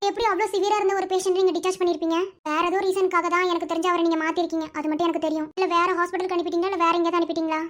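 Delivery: normal talking voice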